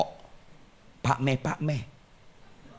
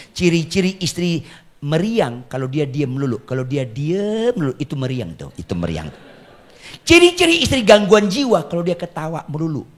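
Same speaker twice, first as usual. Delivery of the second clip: ordinary talk, nothing marked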